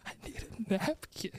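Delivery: soft, quick voiceless whisper; spent, voice all gone; tearful whisper. Real, not acted. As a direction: weakly whisper a request through tears. Tearful man asks for napkin.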